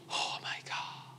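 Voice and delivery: barely voiced whisper; whispered dismay. Diner overwhelmed by the huge bowl, whispered dismay. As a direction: whisper it as a comic aside of despair. Aside after comic suffering.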